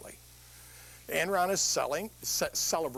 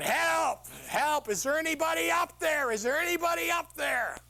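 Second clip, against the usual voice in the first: screaming for help; 11 semitones higher than usual. Acted. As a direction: scream up from the cliff. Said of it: "he starts screaming".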